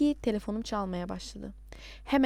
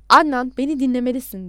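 louder, mildly pleading; 7 dB louder than usual. A narrator's reading. Caller begging Adnan to hear him out, pleading. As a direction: urge them to listen. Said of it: "Yalvarır gibi konuşuyordu".

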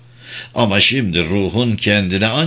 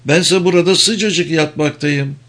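mild exclamation; 7 semitones higher than usual. A narrator's reading. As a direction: insist in a raised pitch without shouting. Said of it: "diye bağırdı". (tiz sesle, hafif çıkışarak)

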